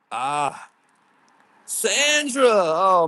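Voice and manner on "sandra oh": high, loud cry of the name; excited shout-out. Real, not acted. Streamer hails Sandra's membership upgrade, excited shout-out. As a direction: call it out in real delight.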